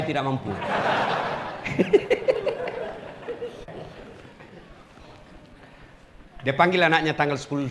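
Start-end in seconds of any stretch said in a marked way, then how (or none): 0.5-4.0 breathy high laugh. Real, not acted. Preacher laughs.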